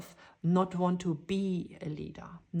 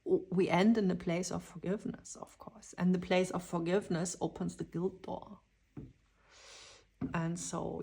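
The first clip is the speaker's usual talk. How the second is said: usual speaking voice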